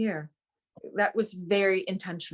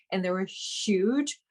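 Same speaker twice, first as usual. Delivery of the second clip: ordinary delivery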